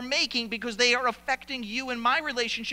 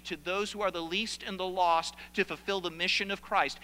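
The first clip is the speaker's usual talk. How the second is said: ordinary talk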